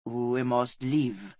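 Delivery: deep, slow, solemn servant. A narrator's reading. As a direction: speak low and slow, drawing the words out. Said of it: "his voice deep".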